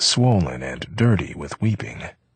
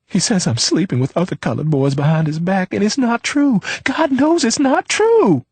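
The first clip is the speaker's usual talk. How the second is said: high, louder crying plea; tearful pleading; 5 dB louder than usual. A narrator's reading. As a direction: wail it through sobs, rising. Leona weeping, protesting innocence.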